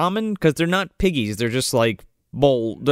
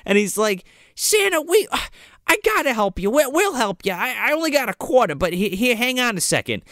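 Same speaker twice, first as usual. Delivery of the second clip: put-on much higher child voice; hammed-up shocked, eager kid; Jimmy promises to help Santa; eager little kid; 8 semitones higher than usual. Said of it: "little Jimmy's like".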